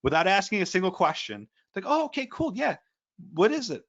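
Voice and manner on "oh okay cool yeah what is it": much higher, light phone-agent voice; high-pitched, breezy compliance; obliging employee. Retold or imitated. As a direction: imitate an all-too-agreeable clerk. Voicing an unsuspecting, eager firm employee.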